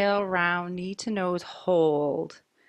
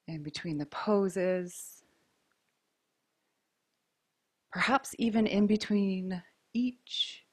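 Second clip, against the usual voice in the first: guided breathing; 5 dB quieter than usual. Acted. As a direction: ease them into the breath gently. Guides slow breathing between poses.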